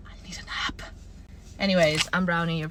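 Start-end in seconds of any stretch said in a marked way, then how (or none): 0.1-0.8 breathy tired whisper. Real, not acted. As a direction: whisper it, worn out. Whispered aside.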